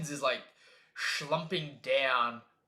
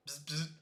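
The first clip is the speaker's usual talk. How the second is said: radio buzz; 9 dB quieter than usual. Retold or imitated. Imitates radio static.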